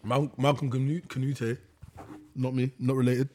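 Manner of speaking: hesitant, stumbling over the name; halting and unsure, restarting; lots of ums and uhs. Real, not acted. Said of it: "that's what's tripping you up".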